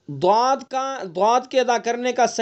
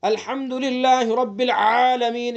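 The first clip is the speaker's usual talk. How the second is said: slow recitation from a choked, squeezed throat; strained recitation; slower than usual. Shown as a demonstration. Teacher imitates people who recite with a strangled throat, slow and strained. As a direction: recite slowly as if forcing the throat shut. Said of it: "اس طرح کی آواز نکال کر کے آپ کو بتا رہا ہوں".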